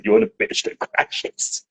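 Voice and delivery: breathy laugh through the words; giggling as you say it. Real, not acted. Laughing at the game twist.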